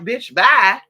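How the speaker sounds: loud, voiced-over sassy dismissal; dismissive brush-off. Rude cashier dismissing customers, loud sassy brush-off. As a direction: flick it off like you're done with them. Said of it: "looking you up and down like".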